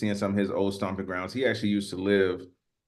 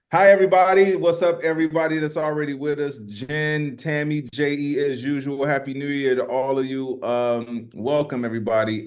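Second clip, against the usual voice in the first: raised, upbeat host welcome; live show host greeting; 4 semitones higher, 4 dB louder than usual. Acted.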